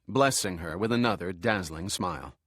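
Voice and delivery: usual speaking voice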